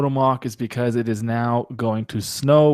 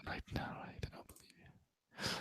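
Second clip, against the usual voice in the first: whisper; 29 dB quieter than usual. Real, not acted. Doubting viewer count aside.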